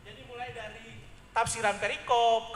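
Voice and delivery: ordinary talk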